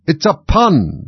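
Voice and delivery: loud, high, indignant; offended outburst with a swooping fall; angry. A narrator's reading. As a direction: insist on it crossly. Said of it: "added in an angry tone".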